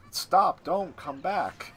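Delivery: token protest, slowing and trailing off; disinterested sarcasm. Acted. Wonka as Mike runs to the TV, disinterested sarcasm. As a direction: go through the motions of objecting. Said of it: "he just employs disinterested sarcasm".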